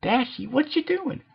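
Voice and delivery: high bubbly cartoon pony voice; Pinkie voice. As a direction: squeak it out as the excitable pony. Pinkie voiced in a read story.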